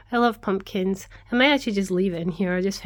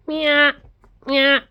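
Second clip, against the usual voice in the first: high, loud meows to the cat; cat meow imitation; 7 semitones higher, 5 dB louder than usual. Shown as a demonstration. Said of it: "I can't do it very well".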